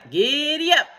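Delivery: high, loud, stretched GIDDY UP; full cowboy whoop of GIDDY UP; yelled. A narrator's reading. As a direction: holler GIDDY UP to the horse. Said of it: "yelled Cowboy McCoy".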